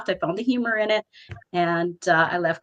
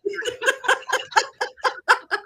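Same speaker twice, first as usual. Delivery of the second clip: high laugh. Real, not acted. Laughs at a crude joke.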